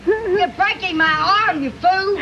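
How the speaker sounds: high, loud, slow yelp of complaint; loud playful protest. Real, not acted. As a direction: yell that he's hurting you. Complaining arm is hurt.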